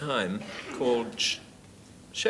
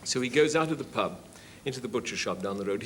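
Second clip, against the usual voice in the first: ordinary talk, nothing marked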